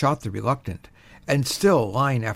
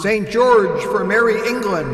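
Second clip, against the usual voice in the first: loud, high battle cry; shouting; 9 semitones higher, 5 dB louder than usual. A narrator's reading. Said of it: "thousands shouting".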